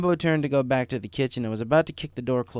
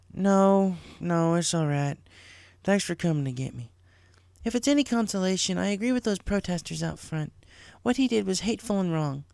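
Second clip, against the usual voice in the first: put-on a little higher, gently firm older sister; a hint of a caring big sister; lightly voiced big sister; 8 semitones higher than usual. A narrator's reading. Big sister settling little sister's fight, lightly voiced.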